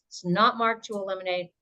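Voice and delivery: ordinary talk, nothing marked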